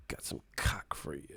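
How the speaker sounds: breathy mock whisper. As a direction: hush it like a secret joke. Mock whisper in livestream bit.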